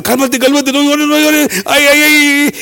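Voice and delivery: high, frantic mock soothing; acting out a nervous adult fussing over a child; anxious. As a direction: panicky pleading, rising in pitch. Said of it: "se estaba poniendo, uh, ansioso, y yo, pues yo también".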